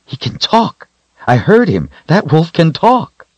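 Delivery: high, loud, disbelieving cry; can't believe what he heard; astonished. A narrator's reading. Richard stunned wolf talks.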